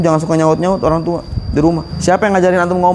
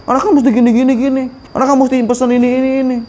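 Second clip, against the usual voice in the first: put-on child talking back; 7 semitones higher, faster than usual. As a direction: play the cheeky kid snapping back at Mum, pitched high. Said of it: "Tinggi nadanya".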